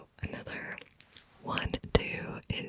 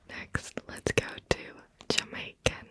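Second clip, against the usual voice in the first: ordinary delivery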